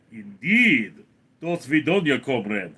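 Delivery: put-on Russian accent, swooping in pitch. Shown as a demonstration. Streamer signing off to a comrade, Russian accent. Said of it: "can you do your Russian accent".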